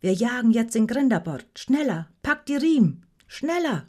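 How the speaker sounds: high, urgent orders climbing in pitch; excited battle-style urging; yelled commands. A narrator's reading. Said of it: "brüllte Rognet".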